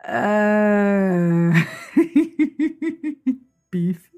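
mock the listener's laughing groan, then drop it flat; imitating a listener groaning at a pun. Host imagines a listener reacting to her bad joke, laughing groan. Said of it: "hear you in the back of my mind going".

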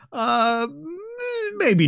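drawn-out, squeaky reluctant admission; reluctant hedge. Pretend. GM conceding the griping was unkind, a reluctant squeak. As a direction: hedge as if forced to admit it. Admitting feedback lacked kindness.

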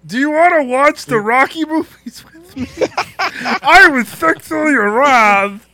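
put-on high, loud nasal man-child; Pee Wee Herman voice. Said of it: "That's more like Pee Wee Herman".

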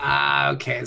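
ordinary talk

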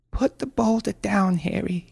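high, careful, anxious plea; pleading mother. Acted. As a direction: coax him desperately in a high voice. Mother pleads with armed son.

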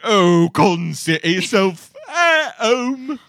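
loud, high hammed-up Cockney; bad Cockney accent. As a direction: overdo the Cockney like a school play. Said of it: "awful Cockney accents".

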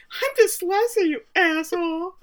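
high, slow, teasing huff; mock indignant retort. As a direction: throw it back high and slow like an offended jab. Mock quote of a snapped insult.

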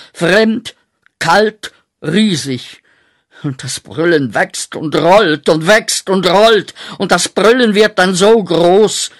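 climbing from loud to a full, high outcry; rising roar. Acted. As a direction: let the roar swell like a tide of the dead. Nightmare roar swelling up.

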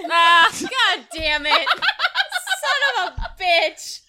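slow laughing curse; laughing groan. Real, not acted. Groan at pun.

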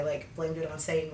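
ordinary talk

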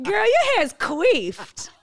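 high, loud, slow laughing words; laughing tease. Real, not acted. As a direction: tease her through laughter. Laughing teasing friend.

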